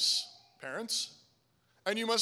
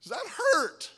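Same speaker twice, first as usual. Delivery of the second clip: very high, louder mock wail; crying child; 11 semitones higher, 5 dB louder, slower than usual. Spanked son to his parent, mock crying. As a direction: imitate a hurt child's cry. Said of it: "And he cries".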